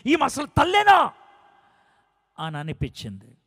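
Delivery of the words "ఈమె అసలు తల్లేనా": much higher and louder, a raging cry of disbelief; furious outburst. Retold or imitated. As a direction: hurl it out in a burst of anger. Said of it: "ఆ క్షణం పిచ్చ కోపం వచ్చింది".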